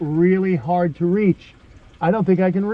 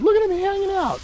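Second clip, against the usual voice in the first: fond pet talk. Real, not acted. Talking fondly to his pet.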